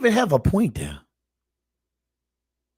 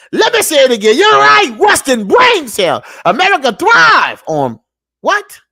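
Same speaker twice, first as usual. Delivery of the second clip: loud, high preaching shout, slowing at the end; fired-up preaching; 8 semitones higher, 7 dB louder than usual. Real, not acted. Vlogger preaching to his viewers, a loud shout. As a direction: drive the point home like a street preacher.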